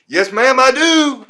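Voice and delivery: high, loud, gleeful acceptance; eager yes ma'am. Retold or imitated. Grinning at the stewardess as he accepts the upgrade, loud and high. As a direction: blurt it out like you just won.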